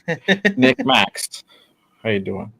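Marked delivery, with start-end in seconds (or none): 0.1-1.4 calling out. Real, not acted. Calling out a guest's name.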